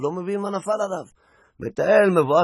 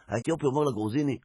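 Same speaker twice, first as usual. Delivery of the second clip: normal talking voice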